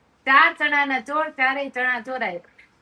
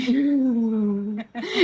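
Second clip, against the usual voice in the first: real amused laugh; laughter. Real, not acted. Listener laughing at a mother's morning complaint, real laughter. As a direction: burst out laughing.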